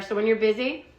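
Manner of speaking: ordinary talk, nothing marked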